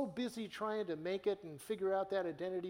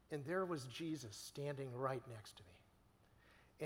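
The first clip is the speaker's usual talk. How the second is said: slow, whispered, unvoiced and much softer; hushed whisper; 4 semitones lower, 7 dB quieter, slower than usual. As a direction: whisper it like a sacred secret. Retelling old friend's vision.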